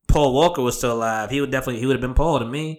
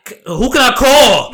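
excited; 11 semitones higher, 9 dB louder than usual. Retold or imitated. Excited about a million-dollar idea.

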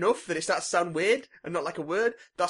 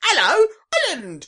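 squeaky mock greeting; 13 semitones higher, 10 dB louder, slower than usual. Mock Tom Holland greeting.

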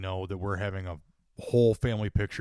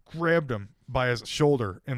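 ordinary talk, nothing marked

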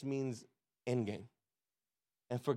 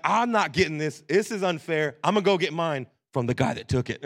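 loud, mildly hammed-up complaint; mock outrage at full volume; indignant protest; 9 dB louder than usual. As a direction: imitate kids declaring it unfair. Kids protesting unfairness, acted out.

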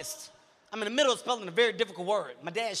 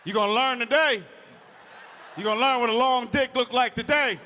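loud dad; 2 semitones higher, 4 dB louder than usual. Retold or imitated. Dad voiced in story.

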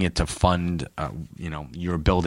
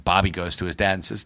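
usual speaking voice

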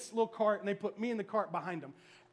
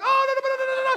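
loud, high-pitched frantic babble; mock panic, rattled out at full volume; panicked praying in tongues; 12 dB louder, faster than usual. Preacher acting out himself on the ride, panicked babbling. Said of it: "I was praying in tongues".

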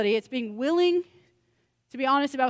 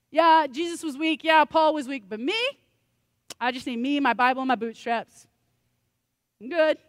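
mock bravado; 4 semitones higher, 5 dB louder than usual.

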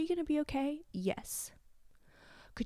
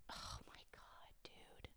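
tearful, almost silent whisper; breaking down mid-sentence, barely audible; choked whisper through tears; real crying; 20 dB quieter, slower than usual. Real, not acted. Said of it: "can't stop crying".

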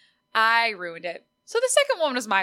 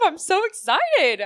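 squealing, pitched way up and slowed; gushing excitement; 8 semitones higher, 6 dB louder, slower than usual. Real, not acted. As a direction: let the excitement burst out high and drawn out. Gushing about podcast topics.

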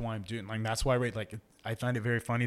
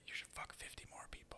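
hushed inner-voice whisper; 18 dB quieter than usual. Retold or imitated. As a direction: whisper it like a devil on your shoulder. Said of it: "this little voice in the back of my head".

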